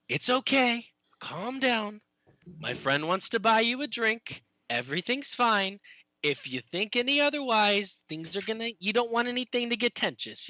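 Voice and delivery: high-pitched soothing character voice; small halfling talking someone down; halfling speaking orcish. Acted. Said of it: "I'm using my American accent for the orcish".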